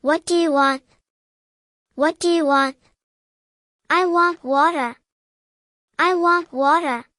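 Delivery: ordinary talk, nothing marked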